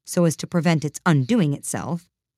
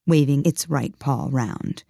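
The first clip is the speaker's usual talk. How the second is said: ordinary delivery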